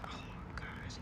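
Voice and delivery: breathless, voiceless oh my gosh; shocked whisper. Retold or imitated. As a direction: whisper it in disbelief, barely audible. Sees man digging in garbage.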